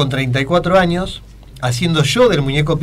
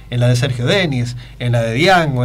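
ordinary delivery

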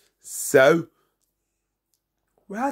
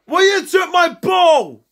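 put-on higher, louder childish accusation; angry shout; 8 semitones higher, 4 dB louder than usual. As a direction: yell it like a wronged kid. Squabble over a ball.

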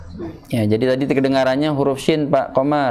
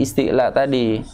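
ordinary talk

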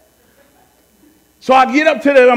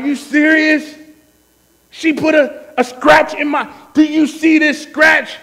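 higher-pitched, worked-up complaint; exasperated outrage; 6 semitones higher than usual. Retold or imitated. Mad dad showing off the car scratch, exasperated. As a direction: act out being mad over a tiny scratch.

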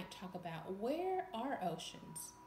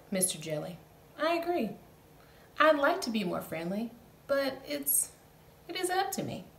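louder, glum fish reply; lightly voiced fish; gloomy fish; 4 semitones higher, 10 dB louder than usual. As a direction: shrug it off sadly. Fish answers jellyfish.

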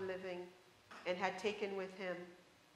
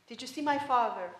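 impatient; 4 semitones higher, 7 dB louder than usual. Acted. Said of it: "asked the daughter impatiently".

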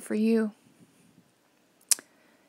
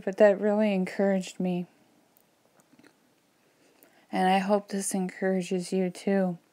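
ordinary talk

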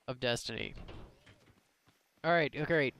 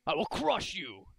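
lightly voiced boastful warlord; barbarian. Boasting battle-bot warrior persona.

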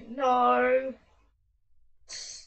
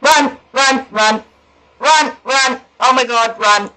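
loud panicked urging to run; being chased; 4 dB louder than usual. Real, not acted. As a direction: flee in panic, yelling. Chased through the maze.

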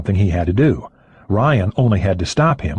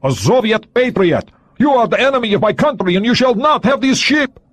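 put-on high, Russian-accented proud declaration; defiant Soviet agent; 13 semitones higher, 3 dB louder than usual. A narrator's reading. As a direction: proclaim it like a zealot, high and loud.

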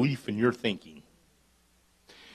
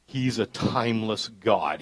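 usual speaking voice

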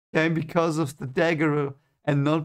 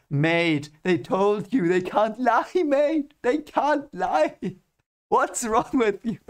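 high, slow, incredulous mate voice; exasperated Matt; 8 semitones higher, 3 dB louder, slower than usual. Retold or imitated. Matt exasperated with Rand, high and incredulous. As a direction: plead with him like he's lost his mind.

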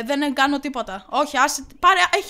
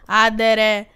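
louder, punched name-calling; repeated insult; 2 semitones lower, 6 dB louder, slower than usual. Real, not acted. Player insulting the gardener, louder each time.

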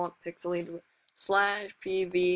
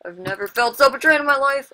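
higher and much louder mock-outraged cry; betrayed outcry; 7 semitones higher, 12 dB louder than usual. Real, not acted. Gamer killed by his teammate, hammed-up betrayed outcry. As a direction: howl it like a wounded friend.

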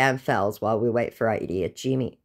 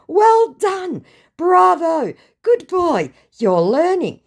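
high, loud, patronising congratulations; mock praise; 12 semitones higher, 6 dB louder, slower than usual. Praising a scammer's sentence, mock congratulatory. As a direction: praise them like a pupil, sarcastically high-pitched.

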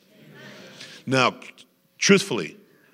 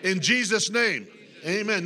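preaching at full force; 12 semitones higher, slower than usual. Acted. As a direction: proclaim it to the whole church.

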